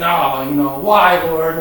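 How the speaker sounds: mock complaint. Imitates own complaint to God.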